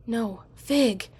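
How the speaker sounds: sudden cry of alarm; panicked cry. A narrator's reading. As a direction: shout the cat's name in panic. Narrator cries out for cat.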